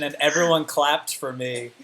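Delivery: ordinary talk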